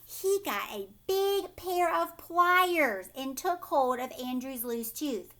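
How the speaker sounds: plain reading voice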